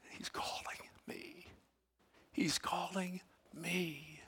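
soft, slow whisper; hushed, as if in awe; slow breathy whisper of recognition. Acted. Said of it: "He said".

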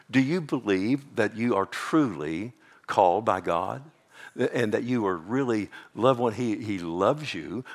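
normal talking voice

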